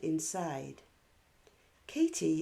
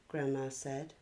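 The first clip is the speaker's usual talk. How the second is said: ordinary delivery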